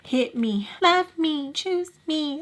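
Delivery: high, chanted mock plea; pissed-off goading. Woman goading her rival after the fight, mock pleading. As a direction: dare them, pitch climbing into mock pleading. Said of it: "I was pissed 'cause I'm like".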